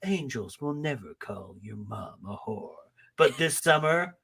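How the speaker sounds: low, soft, dramatic trailer narration; soft deep trailer announcer, building to the twist; movie trailer voice. Pretend. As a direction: intone it like an epic movie trailer. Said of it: "sounds like a movie trailer".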